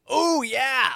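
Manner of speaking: high, excited mock exclamation; acted eager enthusiasm. Actor recalls loving the performance idea, acted eager enthusiasm. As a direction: burst out like a keen actor. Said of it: "I'm like".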